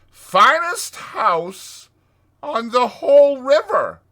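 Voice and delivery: high, loud, drawn-out boast; boisterous. A narrator's reading. Toad brags about his house, boisterous. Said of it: "cried Toad boisterously".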